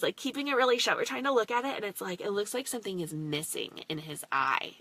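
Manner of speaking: ordinary talk, nothing marked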